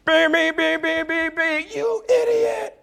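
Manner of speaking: shrill held-note beeping, one pitch throughout; car horn imitation. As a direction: honk like a frantic horn, then yell. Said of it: "they're just beeping the horn like crazy".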